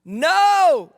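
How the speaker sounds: high, loud, stretched reply; drawn-out shouted answer; calling back. Acted. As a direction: holler it back across the water. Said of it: "you say back".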